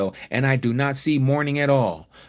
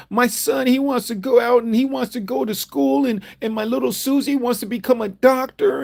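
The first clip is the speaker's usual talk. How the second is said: high, pleading whiny voice of a desperate citizen; mock begging American; 10 semitones higher than usual. Preacher mocking Americans begging their government, high whiny plea. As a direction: beg like a panicked American parent, high and pleading. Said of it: "screaming and yelling and begging their government".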